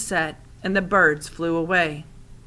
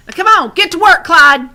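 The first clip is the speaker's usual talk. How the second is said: put-on old woman calling; 10 semitones higher, 14 dB louder than usual. A narrator's reading. Old woman urges the rabbit scarecrow, high loud call. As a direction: holler it at the scarecrow.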